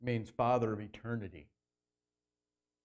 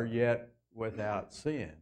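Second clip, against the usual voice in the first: usual speaking voice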